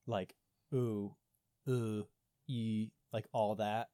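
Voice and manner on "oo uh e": "oo, uh, e" as separate French vowel sounds. Shown as a demonstration. Showing a co-host the many French oo sounds, each vowel said alone. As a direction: demonstrate French vowels one by one: "oo, uh, e". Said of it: "multiple oo sounds in French".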